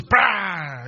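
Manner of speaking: high, loud mimicked thunderclap; explosive bang noise; thunder crack imitation. Shown as a demonstration. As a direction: crash it out suddenly. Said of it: "ele não faz assim".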